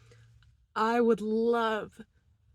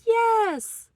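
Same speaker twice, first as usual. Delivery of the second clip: yes popped much higher and louder; bright yes; 12 semitones higher, 8 dB louder than usual. Pretend. Asking viewers about group energy, yes sung up high. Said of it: "an energy that's like".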